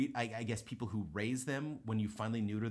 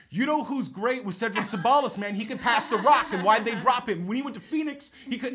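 much louder and higher, pumped-up sports chatter; rapid fire sports talk; 11 semitones higher, 13 dB louder than usual. Retold or imitated. Orderly and patient talk sports to dodge awkwardness, excited loud banter. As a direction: rattle it off like guys talking hoops. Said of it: "it is just this rapid fire sports talk".

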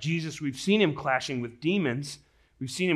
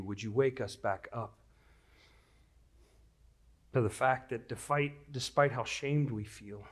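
prayer; 4 semitones lower than usual. Real, not acted. Pastor prays before the sermon.

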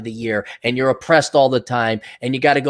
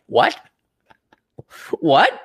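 incredulous what; 4 semitones higher than usual. Retold or imitated. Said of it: "I'm like".

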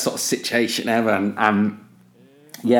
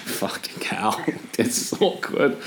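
awkward mutter; 4 dB quieter than usual. Retold or imitated. Mates reacting to the awkward collision.

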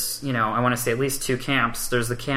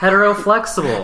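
playful suggestion; 8 semitones higher, 7 dB louder than usual. Real, not acted. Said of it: "That was perfect".

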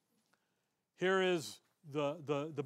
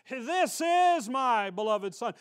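slow, louder public proclamation; proclaimed to the nation; 4 semitones higher, 8 dB louder, slower than usual. Retold or imitated. The Father presents his Son to the nation, slow and loud. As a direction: announce him slowly to the whole crowd. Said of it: "he looks to the nation and says".

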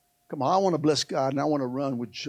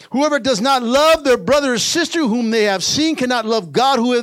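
high, loud, stretched phrase endings; full-force preaching; 9 semitones higher, 9 dB louder, slower than usual. Real, not acted. Preacher tells church that God loves them, impassioned preaching. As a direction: drive each phrase home to the church.

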